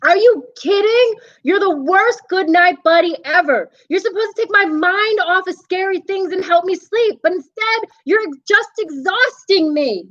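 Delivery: put-on high, worn-out little boy; a kid at the end of his rope; exasperated child voice. Boy scolding the monster buddy, high and exasperated.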